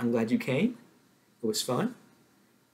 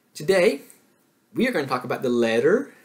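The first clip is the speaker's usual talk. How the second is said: excited announcement; 3 semitones higher, 8 dB louder, faster than usual. Shown as a demonstration. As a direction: announce it like big news. Teacher announces the day's topic.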